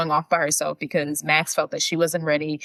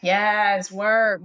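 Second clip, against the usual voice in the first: ordinary talk, nothing marked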